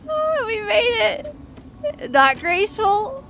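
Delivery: high, loud, slow laughing words; laughing relief. Real, not acted. Just survived the fight.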